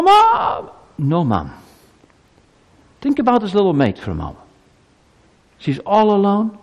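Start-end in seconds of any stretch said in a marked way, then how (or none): 0.0-0.7 put-on high, loud child's cry for mother; sudden high-pitched yell; calling out for Mom. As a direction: call out in the night for your mother. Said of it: "and you call".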